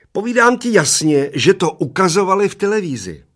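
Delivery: put-on high, loud shriek of a little old man; a tiny old man insisting at a shriek. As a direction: screech it as loud as you can. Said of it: "ječel strašně nahlas".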